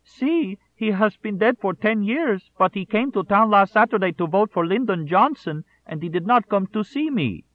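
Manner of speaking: put-on very high, loud Mexican-American accent, sobbing; sobbing Mexican-American boy. A narrator's reading. Boy sobs to his friend in a Mexican-American accent. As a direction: wail it in a little boy's Mexican-American accent. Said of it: "accent was that of a small Mexican-American boy".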